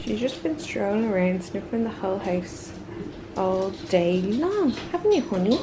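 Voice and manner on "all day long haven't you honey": puppy talk. Real, not acted. Asking puppy in baby talk.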